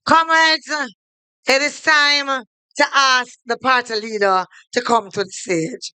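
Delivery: usual speaking voice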